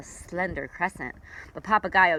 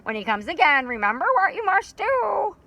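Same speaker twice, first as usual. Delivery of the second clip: louder, higher wise bird warning; clucking parrot; 7 semitones higher, 4 dB louder than usual. Acted. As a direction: warn them like a knowing parrot. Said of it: "cocked his head and clucked".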